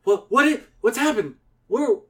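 put-on high, stammering child voice; Andy waking confused. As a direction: wake up bewildered. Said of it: "And Andy wakes up".

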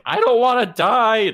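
put-on high, loud, slow wail of a scared kid; hammed-up child's yell; mock yell. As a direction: yell it like a terrified little boy. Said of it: "just, like, yelling in this thing".

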